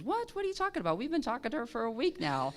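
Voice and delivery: high-pitched shocked "What?", falling back toward normal; a seal realizing he's been fooled all week; shocked disbelief. Retold or imitated. Seal finding out the secretary is a pilot, stunned.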